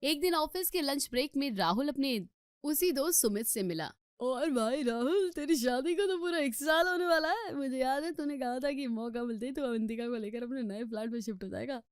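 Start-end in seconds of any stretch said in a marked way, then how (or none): 4.2-11.8 teasing friend. Acted. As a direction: rib your friend cheerfully. Friend Sumit voiced by narrator.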